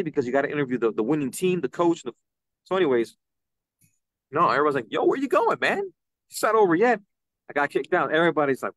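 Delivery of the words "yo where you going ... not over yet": high-pitched imitated disbelief; mock incredulous, high and rising; surprised onlookers. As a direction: call after someone leaving too soon.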